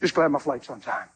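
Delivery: low, mumbled mock sigh of a tired traveler; a touch of defeated traveler; mock weary reply. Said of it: "I'm not like".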